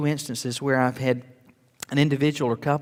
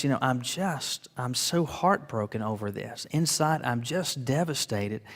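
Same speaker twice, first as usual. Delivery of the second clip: softly retold heartbroken wife; 3 semitones lower, 7 dB quieter than usual. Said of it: "just sobbing".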